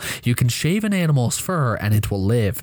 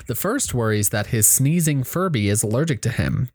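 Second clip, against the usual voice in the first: ordinary delivery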